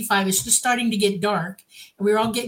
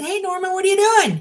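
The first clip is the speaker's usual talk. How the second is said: excited greeting; 10 semitones higher, 5 dB louder than usual. Retold or imitated. Retelling greeting the spirit.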